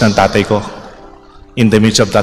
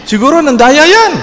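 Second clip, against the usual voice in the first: accusing taunt; 12 semitones higher than usual. Retold or imitated. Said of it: "Sabi ng mga pinsan niya".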